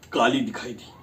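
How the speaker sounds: ordinary talk, nothing marked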